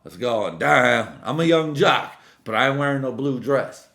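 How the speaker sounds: put-on drunk yelling outside. Drunk-sounding outburst about being jock.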